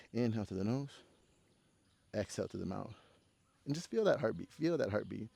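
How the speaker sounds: low, hushed cool-down voice; calming yoga guidance. Real, not acted. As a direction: settle them softly into their breath. Leads a breathing cool-down.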